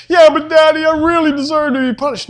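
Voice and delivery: put-on high, pleading kid voice; a child begging Daddy to punish him; whiny child.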